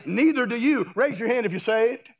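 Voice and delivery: normal talking voice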